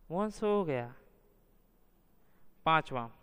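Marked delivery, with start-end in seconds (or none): 0.1-0.9 सो raised high and drawn out, गया falling; exclamatory intonation. Shown as a demonstration. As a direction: lift and stretch सो, then drop on गया. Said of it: "अनुतान".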